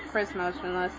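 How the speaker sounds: ordinary delivery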